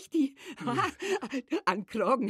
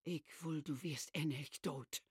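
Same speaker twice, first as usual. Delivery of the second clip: bitter murmur; 9 semitones lower, 14 dB quieter than usual. Acted. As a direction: say it under your breath.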